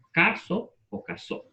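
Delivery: "caso" stressed on ca, then "casó" on so, both slow and deliberate; shifting stress. Shown as a demonstration. Teacher shows the class a stress shift, slow "caso o casó". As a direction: slowly set "caso" against "casó", moving the stress. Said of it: "lo único que he hecho es cambiar el acento".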